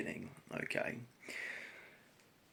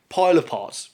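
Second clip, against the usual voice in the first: exasperated; 6 semitones higher, 13 dB louder than usual. Real, not acted. Exasperated emphasis on his words.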